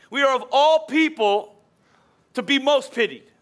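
slow, weighty reading of the verses; scripture reading. Acted. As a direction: read each line slowly and let it land.

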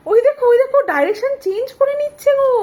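high excited pointing out; thrilled, fast and high. Real, not acted. Excited at toddler turning.

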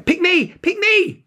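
put-on very high, loud, excited child's plea; eager cry. A narrator's reading. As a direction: beg to be picked, bouncing. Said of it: "she cried".

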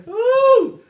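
loud, high whooping cry. Real, not acted. Dad whoops loud and high at the chip's heat. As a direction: let out a big loud whoop.

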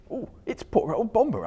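a worried hobbit guessing; Bilbo's voice. Acted. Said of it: "thought Bilbo".

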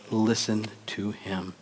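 slow, hushed divine command; solemn command. Acted. The Father comes down to Peter, hushed and solemn. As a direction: say it softly and slowly, with weight.

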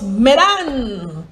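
"Medan" with a long rise and fall on DAHN; corrected pronunciation. Shown as a demonstration. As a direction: say "Medan" as meh-DAHN, slowly. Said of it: "is actually pronounced".

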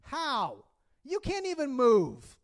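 hammed-up child mockery; kid's retort. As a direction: deliver it like an unfazed five-year-old. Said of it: "He says".

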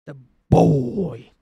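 put-on drawn-out game-character "boy"; mock-heroic father calling his boy; God of War catchphrase. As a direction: echo the stern game dad, stretching the word.

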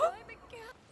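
sharp high gasp of shock. Real, not acted. Gamer gasping as her best healer falls. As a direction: gasp as if something awful happened.

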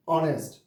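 অনেস্ট starting on the vowel; silent H. Shown as a demonstration. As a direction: say অনেস্ট without any H sound. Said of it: "উচ্চারণ হলো". (অনেস্ট, H ছাড়া উচ্চারণ)

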